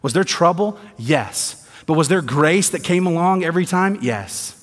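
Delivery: ordinary talk, nothing marked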